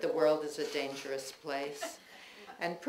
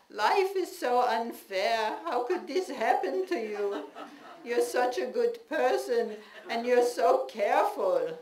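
mother ranting; 6 semitones higher, 6 dB louder than usual. Retold or imitated. Mother ranting about her child's broken ankle, high and loud. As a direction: wail like a fretting old mother. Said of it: "She would rant".

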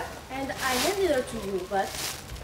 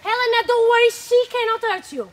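high, loud protective reassurance; loud protective comfort; 7 semitones higher, 9 dB louder than usual. Acted. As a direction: call out to calm her. Lysander reassuring Helena loudly.

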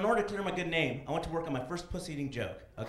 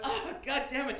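laughing; 10 semitones higher, slower than usual. Retold or imitated. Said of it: "I was like".

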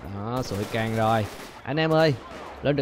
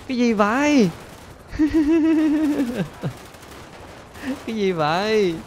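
shocked laugh; 9 semitones higher than usual. Real, not acted. Gamer shocked as the helicopter gets hit. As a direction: cry out then burst laughing.

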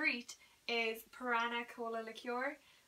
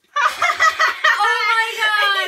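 loud slow laughing shriek; laughing scream; 9 semitones higher, 16 dB louder, much slower than usual. Real, not acted. Reacting to the other's draw.

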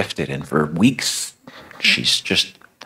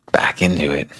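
usual speaking voice

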